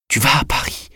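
chuchoté, plus aigu, étranglé par les larmes; la question qui s'étrangle dans un souffle; voix étouffée par les larmes. A narrator's reading. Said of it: "étouffée par les larmes". (Madame de Rênal à Julien, question chuchotée et étouffée par les larmes)

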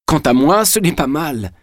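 pleased with oneself, elation reined in; compressed joy. A narrator's reading. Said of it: "en comprimant sa joie".

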